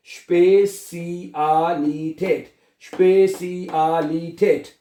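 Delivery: स्पेसियालिटेट slowly, every vowel stretched; स्पेसियालिटेट as slow "shpay-see-aah-lee-tate"; broken into syllables. Shown as a demonstration. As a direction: sound out स्पेसियालिटेट piece by piece. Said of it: "लफ्ज को तोड़ लें".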